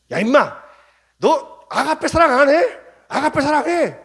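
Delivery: bully him into agape love; pretend scolding shout. 다그치는 주님 상상. (멱살 잡듯 다그치는 고함)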